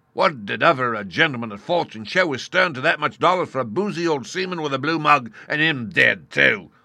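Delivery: high, loud, defiant pirate; defiant pirate captain. A narrator's reading. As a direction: defy man and devil. Silver defies the crew.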